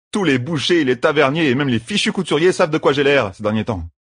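grumbling rogue. Acted. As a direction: toss back an irritated retort. Voices Matt complaining.

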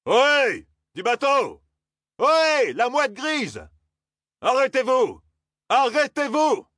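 very high, long-carrying call; shouted hail. A narrator's reading. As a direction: hail a departing boat through cupped hands. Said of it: "cria, les mains en porte-voix". (crier en hélant un bateau)

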